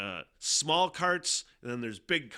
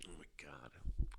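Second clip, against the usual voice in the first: slow whispered groan of embarrassment; 7 semitones lower, 11 dB quieter, slower than usual. Real, not acted. Recalling the car mix-up, a slow whisper. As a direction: breathe it out under his breath.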